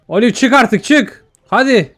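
high-pitched pleading call; impatient urging. Acted. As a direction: urge her out, losing patience. Urging Olive to come out.

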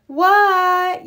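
a high, loud what; yelled. A narrator's reading. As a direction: shout back from outside. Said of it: "yelled Froggy".

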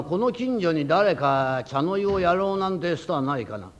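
normal talking voice

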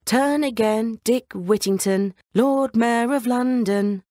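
drawn-out sing-song bells calling Dick; talking church bells. Acted. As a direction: chime the words out slowly like distant bells. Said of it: "The bells rang again".

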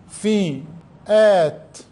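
فئات stretched very long; very slow dictation. Shown as a demonstration. Teacher dictating to pupils, very slow فئات. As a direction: drag out فئات slowly. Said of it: "teacher dictating very slowly".

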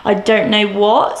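ordinary talk

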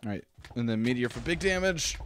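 ordinary talk

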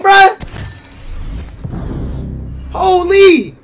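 ordinary delivery

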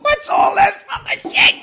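very high, very loud squealed catchphrase; mock shout. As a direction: scream it back at the video.

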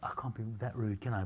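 cheeky whisper to the front rows; naughty stage whisper; whispered aside. Real, not acted. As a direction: drop to a breathy aside, as if only to one person. Aside during a talk.

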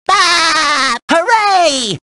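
high cartoon cry of joy; gleeful cheer. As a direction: cheer as if you just won. Cheering the chosen song.

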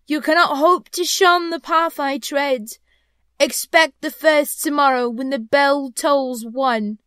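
slow, high, loud pronouncement; stern ghost. Acted. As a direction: lay down the prophecy as a phantom. Ghost warns Scrooge.

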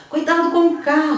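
warning shout. Shown as a demonstration. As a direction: shout it to save someone from a car. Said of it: "você tá gritando". (grito de alerta)

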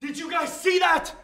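very high, panicked cry; freaked-out squeal, pitch shooting up; frightened outburst. Real, not acted. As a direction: cry out, badly spooked. Frightened by something behind him.

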